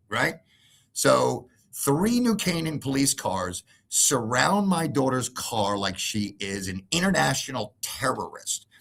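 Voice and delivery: ordinary delivery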